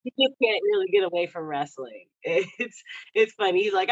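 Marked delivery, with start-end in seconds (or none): none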